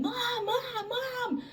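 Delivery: high, thin imitation of screaming children; held-back high-pitched mock scream; mock kids' screaming. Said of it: "they're screaming".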